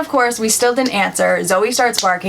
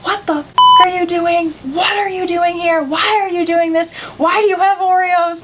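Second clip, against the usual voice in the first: high-pitched mock scolding mother; retold telling-off in a high voice; imitated yelling; 8 semitones higher than usual. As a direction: fire off outraged questions, pitched up without real volume. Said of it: "She yelled at them".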